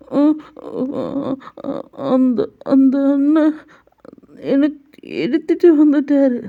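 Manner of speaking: put-on high, halting little-boy voice. Jungkook telling the mother who took him, high childlike voice. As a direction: stumble out the complaint like a scared child.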